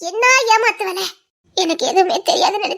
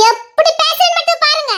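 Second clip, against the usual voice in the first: eager boast; 9 semitones higher, 3 dB louder than usual. Acted. Daughter boasts before fight.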